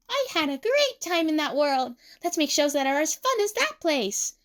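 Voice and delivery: high, happy enthusiasm; Emu. A narrator's reading. Emu proposes fun shows to Tsukasa, high and happy.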